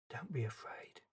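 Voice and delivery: soft whisper close to the ear. Acted. Jesus comforting the grieving father up close, whispered. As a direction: breathe it gently into his ear.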